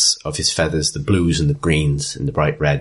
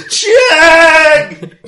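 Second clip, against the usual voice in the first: much higher and louder, a full bellow across the room; bellowing name; 11 dB louder than usual. Acted. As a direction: roar the name like calling a lost pet. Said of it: "He bellows".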